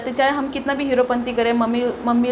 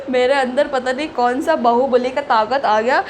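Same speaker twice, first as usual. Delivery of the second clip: usual speaking voice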